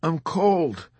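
high, plaintive bleat of agony; feeble whimper. A narrator's reading. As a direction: whimper weakly, wounded and freezing. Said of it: "whimpered feebly ... in a bleat of plaintive agony".

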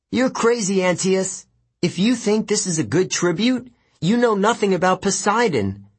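high, defiant retort. A narrator's reading. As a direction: tell the giant off. Percy defying giant.

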